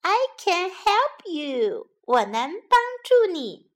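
high, slow little mouse squeak. A narrator's reading. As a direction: squeak it brightly like a tiny mouse. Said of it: "小老鼠吱吱地说".

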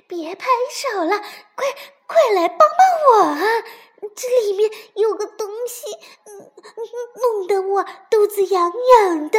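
loud, high-pitched frantic yelling through giggles; shouting for help. A narrator's reading. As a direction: cry out as if helplessly tickled. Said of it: "蹦蹦却大叫道". (又痒又急地大叫)

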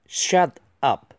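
"shut up" in two separate words; careful, separated model reading of "shut up"; slow word-by-word. Shown as a demonstration. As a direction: split "shut" and "up" with a clear gap. Slow model reading, then faster repeat.

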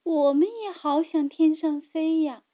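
usual speaking voice

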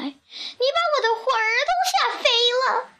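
put-on high, fast, startled old woman; acted scared squeal at a rapid pace; frightened old woman's cry. A narrator's reading. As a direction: blurt it out high and panicky. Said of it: "瘦小的老妇人喊起来".

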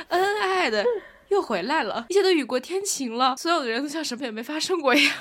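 high and loud, laughing through the words; amused disbelief, rising in pitch. Real, not acted. Laughing at parents making up. (笑着说，又好气又好笑)

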